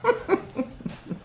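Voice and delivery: airy burst of laughter; breathy laugh. Real, not acted. Laughing at own slip.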